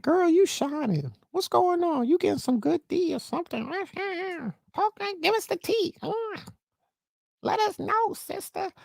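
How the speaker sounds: put-on very high sassy girlfriend voice; gossiping girlfriends. Friends asking the girl for gossip, very high and sassy. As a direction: squeal like a nosy best friend. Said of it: "and they're like".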